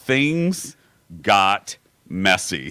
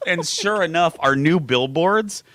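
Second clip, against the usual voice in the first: usual speaking voice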